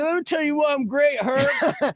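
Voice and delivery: high-pitched puffed-up self-mockery; pompous high mock voice; mock self-important boast. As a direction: send up your own bragging in a high voice. Mock-quotes himself getting high on himself.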